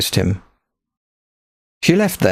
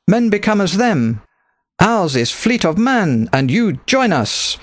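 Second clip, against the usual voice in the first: put-on higher, thick-accented rebel captain, proud; accented pirate captain; 8 semitones higher than usual. A narrator's reading. Pirate captain recruiting Nicholas to her fleet, thick accent. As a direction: speak as a bold foreign pirate captain.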